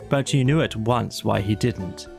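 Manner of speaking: plain reading voice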